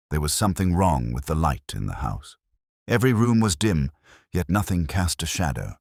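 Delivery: ordinary delivery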